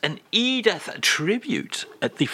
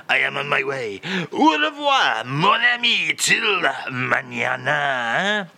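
[name]. Acted. French guest departs the show, mock [name]. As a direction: sing out goodbye with a theatrical French flourish.

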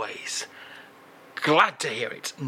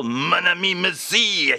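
hammed-up French crooner accent; 5 semitones higher than usual.

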